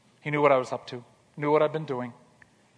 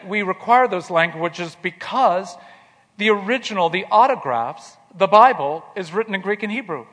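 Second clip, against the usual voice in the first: mock-stern professor; 4 semitones higher, 6 dB louder than usual. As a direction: lay down the rule like a professor. Retelling the professor's stern reply.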